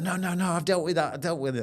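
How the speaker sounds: desperate, rising protest against being dragged back; panicked refusal. Retold or imitated. Retelling being dragged toward the door, panicked pleading. Pulled into the room again, resisting.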